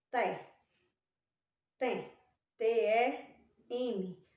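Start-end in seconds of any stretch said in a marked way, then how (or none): 2.6-4.2 T E M spelled slowly, letter by letter; slow spelling of T E M. Shown as a demonstration. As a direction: spell T E M slowly and clearly for children. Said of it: "Antes de P e B só se escreve M".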